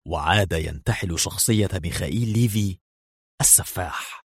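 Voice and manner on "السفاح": ordinary delivery, nothing marked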